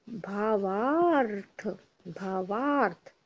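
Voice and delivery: भावार्थ drawn out slowly, each syllable stretched; भावार्थ said very slowly for dictation; slow spelling. Shown as a demonstration. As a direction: stretch भावार्थ for the class to write. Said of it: "देखो".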